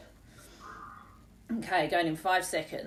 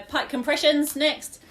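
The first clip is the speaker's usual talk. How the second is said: bright, louder class cue; energetic announcing; 7 semitones higher, 6 dB louder than usual. Shown as a demonstration. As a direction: call out the next move to the class. Coach announcing the next exercise.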